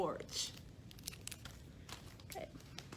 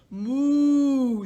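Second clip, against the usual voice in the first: mooing cow; 3 semitones higher, 3 dB louder than usual. Acted. Cow's reply in story.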